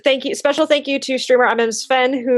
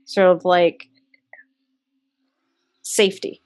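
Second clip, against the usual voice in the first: soothing narration; 4 semitones lower than usual. Acted. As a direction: ease them into a sense of comfort. GM describes comforting warmth.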